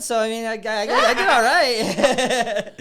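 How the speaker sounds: high, loud laughing. Real, not acted. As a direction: laugh through it, stretched slowly. Laughing at own joke.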